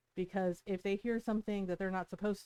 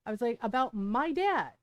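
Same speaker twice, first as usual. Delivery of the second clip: higher-pitched, indignant retold telling-off; heated confrontation, retold with raised pitch; retold roasting; 7 semitones higher, 4 dB louder than usual. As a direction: re-enact tearing into someone. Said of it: "And I roasted her".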